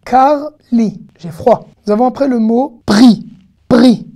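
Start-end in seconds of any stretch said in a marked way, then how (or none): 3.7-4.1 "Pri" loud and higher, in one syllable. Shown as a demonstration. Teacher modelling the word for fruit, one-syllable pri. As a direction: say "Pri" with no vowel between p and r. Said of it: "ce mot se prononce pri".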